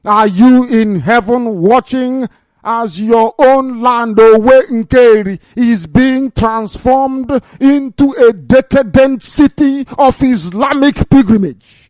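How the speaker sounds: slow, high, loud plea to heaven; outraged lament. Real, not acted. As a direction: hammer out each word, demanding that God look. Outraged plea to God over homeland.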